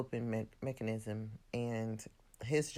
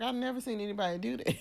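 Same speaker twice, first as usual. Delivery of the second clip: quick, high mock disbelief; high-pitched, hurried imitation of bafflement; retold disbelief; 9 semitones higher, faster than usual. Voicing Miles's baffled confessional.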